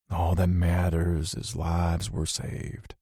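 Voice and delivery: soft, slow, weak fatherly voice; fading father making peace, hushed and tender; dying dad speaking to his son, softly; lightly voiced dying father. A narrator's reading.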